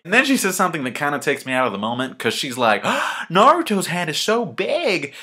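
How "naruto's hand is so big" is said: high, drawn-out mock swoon after a gasp; swooning girl impression. Hinata marvelling at Naruto's hand, mock swooning. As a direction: gush in a high, put-on voice. Acting out Hinata's awed line.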